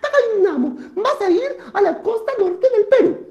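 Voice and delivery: very high put-on deity voice; moon giving orders. Moon sends Takainamo to the north coast, high-pitched. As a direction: pitch it way up, theatrical and insistent.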